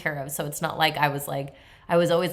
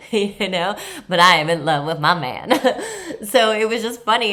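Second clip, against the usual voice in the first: real laughter breaking through, louder; laughing; 6 semitones higher, 7 dB louder than usual. Real, not acted. Telling viewers about the flirty guard, laughing. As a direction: laugh it out, loud and high.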